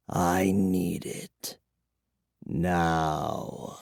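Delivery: very soft, low, slow; a desperate hushed need; craving vampire. A narrator's reading.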